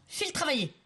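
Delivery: high, sharp command; angry order. Acted. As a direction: send him off to work, harshly. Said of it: "hurla-t-il".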